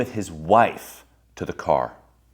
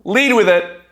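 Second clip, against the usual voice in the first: loud proclamation; 10 dB louder, slower than usual. Shown as a demonstration. Speaker gives the crowd the cure for shame, loud. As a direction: shout Lead with it, high and loud. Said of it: "the number one antidote".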